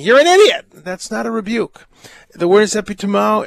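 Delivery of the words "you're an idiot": sharp, loud, high insult; angry insult. Retold or imitated. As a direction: snap it at them like an insult. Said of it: "of someone saying".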